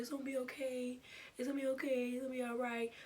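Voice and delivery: soft comforting retold voice; gently calm a crying friend; imitated tender, hushed comfort; soothing reassurance. Friend comforts her as she cries.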